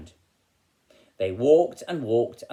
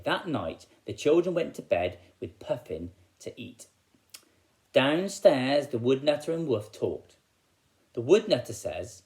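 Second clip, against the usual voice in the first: plain reading voice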